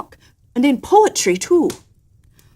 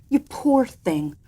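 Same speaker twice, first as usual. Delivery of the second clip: slow, soft pity; tender sympathy; 3 semitones higher, 4 dB quieter, slower than usual. Acted. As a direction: soothe him gently. Daughter comforts father.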